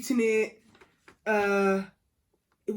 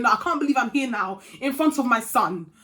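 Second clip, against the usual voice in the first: angry; 3 semitones higher, 8 dB louder than usual. Retold or imitated. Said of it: "I was so angry at this point".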